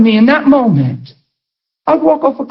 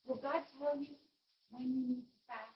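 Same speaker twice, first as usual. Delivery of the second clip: ordinary delivery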